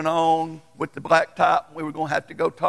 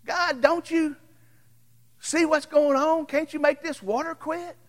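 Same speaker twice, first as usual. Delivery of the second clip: pleading prayer; 11 semitones higher than usual. Retold or imitated. Pastor pleading with God.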